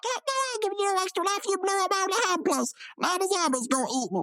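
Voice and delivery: high-pitched cartoon game character; mock scientist voice. Game scientist refusing the player, mock high voice.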